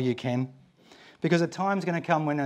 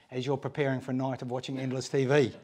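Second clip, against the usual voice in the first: ordinary talk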